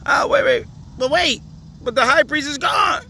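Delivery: very high, squeaky mock objection; high-pitched put-on disbelief; mock protest. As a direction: protest in a jumped-up falsetto, playing baffled.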